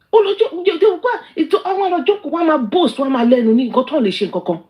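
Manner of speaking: high-pitched, indignant outburst; scornful rant. Real, not acted. Streamer ranting about boastful critic Sele, indignant. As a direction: rant rapidly rising high, stumbling over the start.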